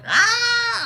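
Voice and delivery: very high mouse shriek; squeaky mouse shriek. Retold or imitated. Panicked mouse shrieking high at the man. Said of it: "He's like".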